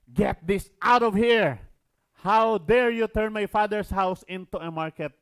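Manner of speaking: loud, slow shout of outrage; angry command. Acted. Jesus to dove sellers, furious loud shout. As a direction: drive them out with fury. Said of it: "he said".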